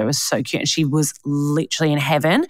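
usual speaking voice

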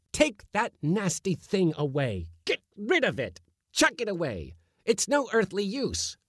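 high, disgusted rage; raging. A narrator's reading. Uncle in a rage ordering the kitten thrown out, high. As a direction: throw the cat out in fury. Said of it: "my uncle flew into a rage".

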